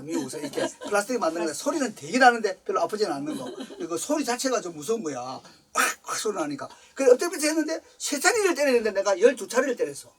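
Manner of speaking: ordinary delivery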